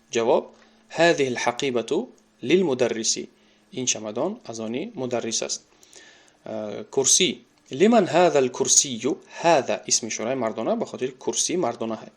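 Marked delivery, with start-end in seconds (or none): none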